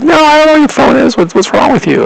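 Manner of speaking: very high, incredulous mock voice of the driver; irritated driver. The truck driver to the frantic stranger, incredulous and high. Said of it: "She's like".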